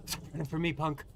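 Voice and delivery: quiet taunt. Acted. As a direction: say it low to yourself. Repeats taunt under breath.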